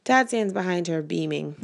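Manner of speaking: plain reading voice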